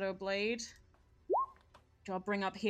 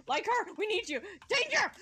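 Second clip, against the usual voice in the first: high, loud cry for help; urgent call for help; 8 semitones higher, 4 dB louder than usual. Retold or imitated. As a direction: shout it as an alarm. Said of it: "he's gonna be like".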